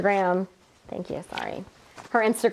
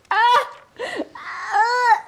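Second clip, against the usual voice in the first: mock sobbing wail; 11 semitones higher than usual. Co-host mocking a contestant crying on the sidelines, mock wail. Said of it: "on the sidelines like".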